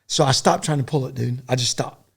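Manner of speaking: ordinary delivery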